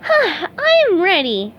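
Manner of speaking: high, very slow steadied resolve; determined. A narrator's reading. SpongeBob facing the coach, slow determined voice. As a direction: breathe and commit. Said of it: "SpongeBob took a deep breath".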